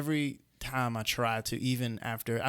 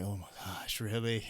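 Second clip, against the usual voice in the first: much softer, lower, stunned disbelief; awed hush; 3 semitones lower, 6 dB quieter than usual. Retold or imitated. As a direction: gasp it out, star-struck. Awed at meeting idol.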